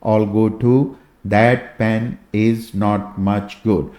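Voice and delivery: formula read word by word, very slowly; slow recitation. Shown as a demonstration. Teacher reciting the memory formula slowly for students. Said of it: "formula है आपको पहले तो याद करना है".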